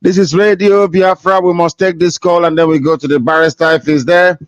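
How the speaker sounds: normal talking voice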